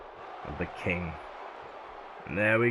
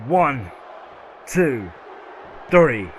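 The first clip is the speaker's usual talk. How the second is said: rising count; 4 semitones higher, 6 dB louder, much slower than usual. Real, not acted. Commentator counting the pinfall, building to a shout.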